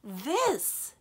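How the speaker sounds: loud, exasperated outburst. Acted. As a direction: throw the word out in exasperation. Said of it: "the fairy said in exasperation".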